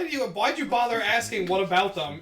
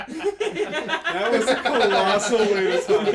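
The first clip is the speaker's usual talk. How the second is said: laughing, slow and drawn out; 2 semitones higher, 7 dB louder, much slower than usual. Real, not acted. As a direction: chuckle through the words. Laughing at wasted day.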